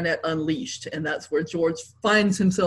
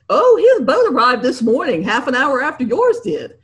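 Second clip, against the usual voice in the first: louder, higher blurt; blurted news; 5 semitones higher, 6 dB louder than usual. A narrator's reading. George blurting bad news.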